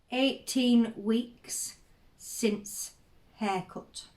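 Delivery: slow, halting, long gaps between words; word-by-word dictation. Acted. Elderly diarist noting small news to herself, word-by-word dictation. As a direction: say it slowly as if writing it down.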